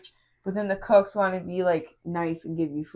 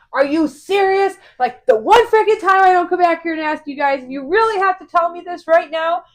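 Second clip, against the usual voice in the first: high, loud disbelieving rant; exasperated outburst; 9 semitones higher, 6 dB louder than usual. Retold or imitated. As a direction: make a scene at the cook. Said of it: "making a whole scene in the kitchen".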